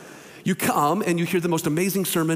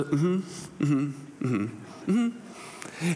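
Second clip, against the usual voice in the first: soft, lower, fading mm-hmms; a friend humoring him, getting softer; patient friend listening; 5 semitones lower, 7 dB quieter, much slower than usual. Retold or imitated. Friend humoring the pastor's complaints on the phone, with soft fading mm-hmms.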